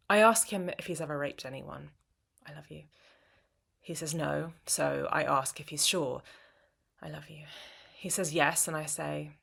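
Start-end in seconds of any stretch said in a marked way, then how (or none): 2.5-2.8 soft murmur. Acted. Lover's reply in poem. 7.0-7.4 soft murmur. Acted. Lover's reply in poem.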